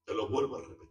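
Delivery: loud, high preaching at full force; fiery preaching. Acted. Preacher proclaiming grace to his congregation, fiery preaching. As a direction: hammer the message home to the congregation.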